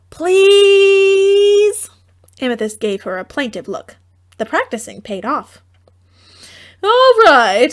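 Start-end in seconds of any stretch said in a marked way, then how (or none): 0.1-1.9 high, loud, drawn-out please; plaintive plea. A narrator's reading. Plaintive look, practiced. 6.8-7.7 high, loud resigned mother; giving in mother. A narrator's reading. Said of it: "said Amethyst's mother".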